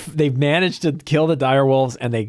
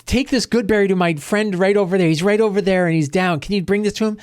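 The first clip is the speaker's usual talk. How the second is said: a touch higher, coaxing a pet; hint of a friendly adventurer bargaining with a bunny; lightly voiced pleading hero; 5 semitones higher than usual. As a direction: gently ask the rabbit for a favour. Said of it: "Perrin is like".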